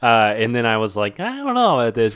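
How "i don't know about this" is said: high-pitched mock reluctance; retold doubt. Recalling his reaction to younger characters, doubtful. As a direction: lift it high, voicing wary doubt.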